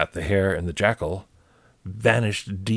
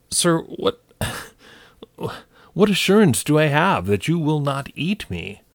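jackal voice; 7 semitones higher than usual. Acted. Jackal voiced, high and nervous.

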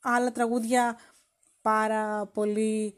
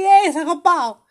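loud, raised street call; mock shout; 5 semitones higher, 9 dB louder than usual. Man spots a girl in the street, mock shouting at her. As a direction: hail a passing stranger loudly. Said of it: "και της φώναξε".

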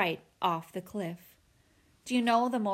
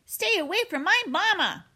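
put-on high-pitched, defiant young creature; protective gosling; 10 semitones higher, 4 dB louder than usual. A narrator's reading. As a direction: cry out high to defend your mother. Gosling defends his robot mother.

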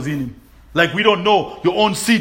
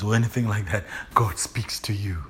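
soft low whispered truth; hushed sincere whisper; 8 semitones lower, 8 dB quieter than usual. Real, not acted. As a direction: whisper it like a secret. Intimate close to sermon point.

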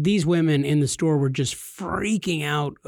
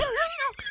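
high laugh; 9 dB quieter than usual. Real, not acted. After the hero joke.

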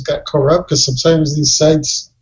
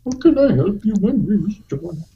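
higher-pitched Indian call-centre voice; mock Indian accent; 5 semitones higher than usual. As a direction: greet a caller in a put-on Indian accent. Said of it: "hardcore accent".

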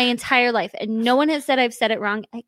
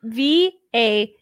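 spelling out; much slower than usual. Real, not acted. Woman spells out the word for confused friends, slow spelling. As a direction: slowly spell V A L U Es letter by letter. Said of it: "trying to spell out the alphabet".

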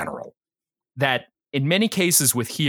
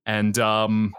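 usual speaking voice

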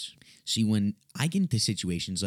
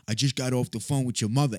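higher, put-on needling voice; pointed tone; 5 semitones higher than usual. Caller tells the kid about his mother, pointed imitation. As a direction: lightly imitate a provoking caller. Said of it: "And in a tone of voice in which he said".

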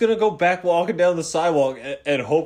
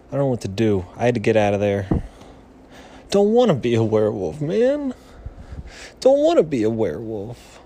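low grumble; 6 semitones lower than usual. Acted.

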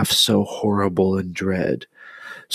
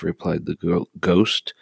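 plain reading voice

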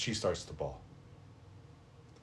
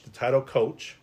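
ordinary talk

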